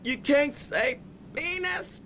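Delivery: usual speaking voice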